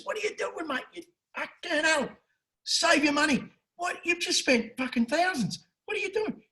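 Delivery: very high, strained-up mock disbelief; high incredulous scolding, played for the story; acted exasperated protest. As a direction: berate him in a high voice without shouting. Acted owner berating the backer.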